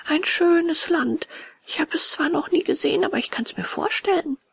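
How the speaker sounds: put-on high boy voice full of wonder; dreamy puppet boy. A narrator's reading. Puppet imagines the land without schools, high dreamy voice.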